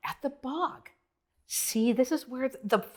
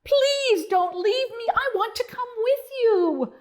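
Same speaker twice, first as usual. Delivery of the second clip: pleading bellow; 10 semitones higher than usual. Acted. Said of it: "Bonzo bellowed".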